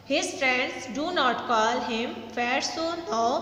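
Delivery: very slow, every word spaced out for the class; slow dictation read. Shown as a demonstration. Teacher reading a sentence to students, very slow and deliberate. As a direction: read it slowly, word by word, so students can follow. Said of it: "teacher rereads the sentence".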